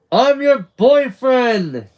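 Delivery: high, loud, drawn-out yell; joyful shouted announcement; elated scream. A narrator's reading. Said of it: "Charlie just screams".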